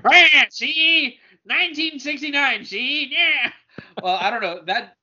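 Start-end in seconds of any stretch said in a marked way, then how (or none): 0.0-3.5 high nasal-free wiseguy gangster voice, see; old-time mobster talking tough; 1920s Chicago gangster. Pretend. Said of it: "read it in your nineteen twenties Chicago gangster voice".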